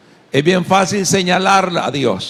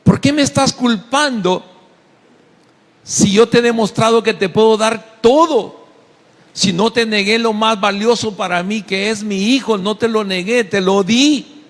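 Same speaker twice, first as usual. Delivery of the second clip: voiced as God, pitched higher, pleading; 6 semitones higher than usual. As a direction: speak as a wounded, generous God. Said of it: "dice Dios".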